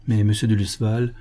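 plain reading voice

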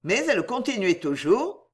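usual speaking voice

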